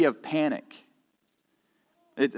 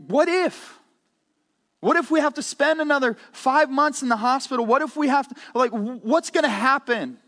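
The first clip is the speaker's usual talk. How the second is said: anxious what-ifs; 10 semitones higher, 4 dB louder than usual. Retold or imitated. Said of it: "threatening to overwhelm and say".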